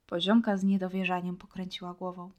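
ordinary delivery

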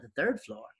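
high, pointed questions; surprised questioning. Retold or imitated. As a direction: press him suspiciously. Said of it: "He's like".

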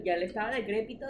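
ordinary talk, nothing marked

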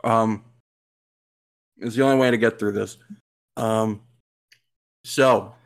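talking through cookie bites; chewing while talking. Real, not acted. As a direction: speak with a mouth full of cookie. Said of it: "Sorry, I'm eating cookies".

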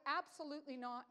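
normal talking voice

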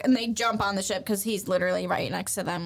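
ordinary talk, nothing marked

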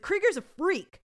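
upset outburst, high and loud. Retold or imitated. Tommy blurting to Stabler about Krieger, acted upset cry. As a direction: burst out in mock distress. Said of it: "Tommy gets upset".

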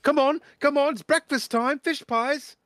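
high, loud breakfast call; excited wake-up caller. Acted. Young man knocking on every door, high excited calling.

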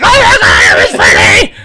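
high, loud hammed-up scream; mock terrified shout. Retelling Nancy waking from the nightmare, hammed-up scream. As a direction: shriek it like a horror heroine. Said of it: "she's like".

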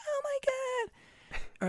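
very high, drawn-out imitation of her; exaggerated falsetto of exasperated recognition; mock squeal. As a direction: squeal it slowly in a tiny high voice. Imitating drive-through worker recognizing them.

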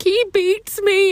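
high, drawn-out mock wail; childish wail. Child tattling to grandma on dad, hammed-up wail. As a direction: wail it slowly, like a dramatic kid.

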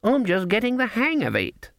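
put-on high, eager-anxious old woman; anxious grin. A narrator's reading. Granny begs sharpers to keep playing, anxiously. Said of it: "grinning anxiously".

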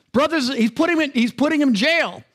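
ordinary talk, nothing marked

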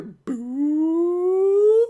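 high, loud little boop; imitated gun beep. Kay powering up the gun inside, high boop. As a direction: pop out a bright electronic boop.

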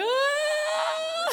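long, high, hammed-up wail; imitated crying wail. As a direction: burst into exaggerated bawling. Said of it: "And I'm, like, crying".

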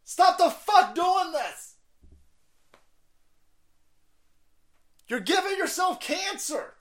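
high, strained slow yell; cancer society scolding. Acted. Cancer society warning patients, high exasperated yell. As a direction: holler a drawn-out exasperated warning. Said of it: "Canadian Cancer Society should be like".

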